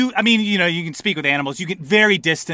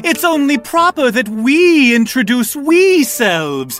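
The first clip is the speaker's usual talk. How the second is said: high, loud, drawn-out gnome voice; showy little gnome herald; prankster gnome; 10 semitones higher, 6 dB louder, slower than usual. Acted. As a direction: announce yourself grandly in a high pitch. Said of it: "the gnome steps forward again, even closer to you, and says".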